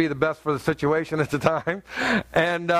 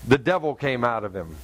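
usual speaking voice

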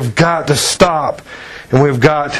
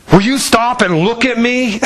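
imitated exasperated plea, higher pitch; frustrated demand; 6 semitones higher than usual. Woman demanding her distracted listener's attention, imitated frustration. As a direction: plead for attention, fed up. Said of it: "so funny the way she said it, 'cause you could see her frustration level".

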